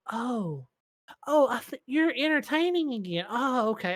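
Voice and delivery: imitating mom, high-pitched as it clicks; dawning realization.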